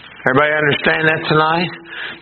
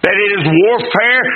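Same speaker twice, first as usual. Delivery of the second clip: ordinary talk, nothing marked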